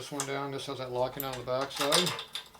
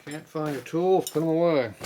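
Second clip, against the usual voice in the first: calling out; 4 semitones higher, 9 dB louder than usual. Real, not acted. Answering someone upstairs, calling out.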